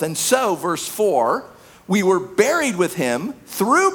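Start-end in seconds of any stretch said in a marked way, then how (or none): none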